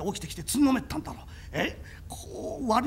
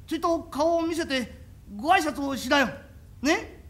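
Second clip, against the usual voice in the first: nervous coaxing; 4 semitones higher, 8 dB louder than usual. Acted. Coaxing hidden master out.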